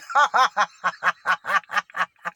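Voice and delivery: loud, rolling belly laugh; hearty laugh. Real, not acted. Vlogger letting out the promised hearty laugh, loud and long. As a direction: let it all out. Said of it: "let out a hearty".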